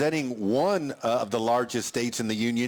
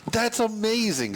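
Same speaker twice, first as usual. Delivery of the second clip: bright, lifted exclamation of delight; excited; 8 semitones higher than usual. Real, not acted. Host marvels at the contestant's two titles, excited and high. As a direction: react with real delight, pitched up. Host reacts to guest's story.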